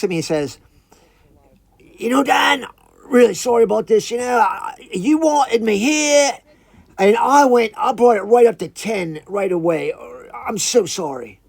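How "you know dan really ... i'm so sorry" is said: high, loud put-on iguana voice; bummed-out apologetic iguana. Iguana apologizing to the upset visitor, high put-on voice. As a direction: apologize hard as a little creature. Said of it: "he's a little bummed out".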